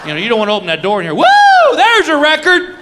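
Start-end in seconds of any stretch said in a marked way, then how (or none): 1.1-2.7 very high, loud whooping cheer; hammed-up stadium whoop; mock crowd cheer. Imagined waiting room cheering.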